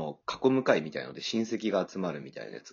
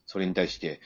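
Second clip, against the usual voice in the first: usual speaking voice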